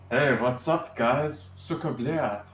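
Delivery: a mock Russian accent greeting. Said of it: "My Russian accent is not very good, but I will try".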